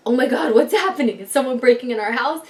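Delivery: mildly panicked, acted-out alarm; retold panic. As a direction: act out waking in a mild panic. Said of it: "wake up in a complete fucking panic".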